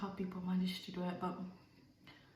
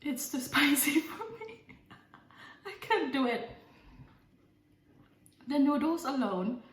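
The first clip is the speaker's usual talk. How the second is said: laughing; 6 semitones higher, 3 dB louder than usual. Real, not acted. Mouth burning, laughing.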